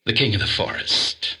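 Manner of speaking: ordinary talk, nothing marked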